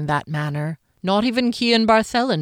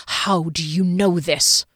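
slow, cold, controlled calm; icy calm; slower than usual. A narrator's reading. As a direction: hold the fury under calm. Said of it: "voice was ironically calm".

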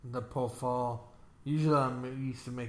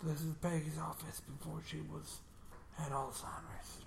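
whisper; 12 dB quieter than usual. Real, not acted. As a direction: whisper it so nobody hears. Whispering in the office.

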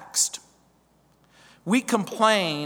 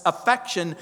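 ordinary delivery

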